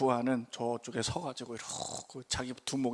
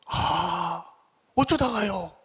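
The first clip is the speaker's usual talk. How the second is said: mildly startled "how did that happen", a bit higher; surprised reply; 4 semitones higher, 4 dB louder, much slower than usual. Retold or imitated. Pastor surprised by the boss's call, mild alarm. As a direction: react with light concern.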